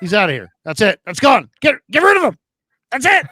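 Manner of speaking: high, loud hammed-up glee; joking, loud mock eagerness to dump a co-host; mock gleeful ejection. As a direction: cheer like you're tossing him out.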